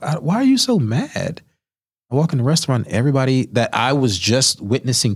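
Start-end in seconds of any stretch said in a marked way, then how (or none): 0.0-1.4 baffled question. Retold or imitated.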